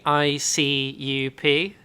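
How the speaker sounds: letters spelled out slowly; slow spelling. Acted. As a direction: spell each letter deliberately.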